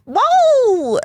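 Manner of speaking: loud high-pitched whoa. Retold or imitated. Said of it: "And then she goes".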